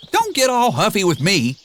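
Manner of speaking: high, fast indignant donkey; defensive donkey. A narrator's reading. Donkey defending himself to Shrek, fast and high. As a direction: rattle off the excuse.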